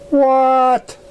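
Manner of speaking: high sharp yell; yelled what. A narrator's reading. Carla yelling at Twilight in surprise, high yell. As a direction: shoot up into a startled yell. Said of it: "she yelled".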